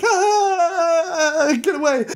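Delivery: high-pitched yelp of a fleeing guard; mock panicked scream. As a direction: play a comic shriek, drawn out then words. Guard flees on his mouse.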